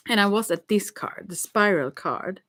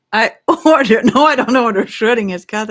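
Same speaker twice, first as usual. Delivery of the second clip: loud laughing protest; 4 semitones higher, 10 dB louder than usual. Real, not acted. Laughing protest after the joke.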